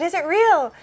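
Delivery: high, loud, eager question; child asking wide-eyed, half-shouting; excited child. Retold or imitated. Little girl asking mom, loud and high with excitement. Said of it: "And I said".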